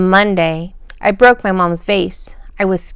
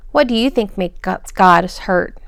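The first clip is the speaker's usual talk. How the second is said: usual speaking voice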